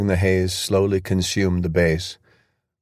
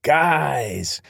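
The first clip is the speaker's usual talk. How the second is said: enthusiastic; 7 semitones higher than usual. Acted. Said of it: "an enthusiastic voice".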